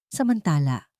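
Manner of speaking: ordinary delivery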